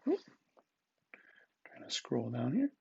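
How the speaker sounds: whispered under the breath to herself; muttered aside. Real, not acted. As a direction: mutter it quietly while busy scrolling. Muttered aside while scrolling.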